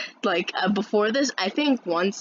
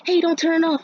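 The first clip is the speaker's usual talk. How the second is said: higher, louder objection; retold protest; 9 semitones higher, 5 dB louder than usual. Classmate objects to music being turned off, protesting. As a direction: call out to stop someone.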